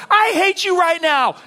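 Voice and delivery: mock kid's outburst, high and loud; hammed-up child's tantrum shout; angry kid. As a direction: yell it slowly like a furious child at a parent. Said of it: "had your kid look at you and go".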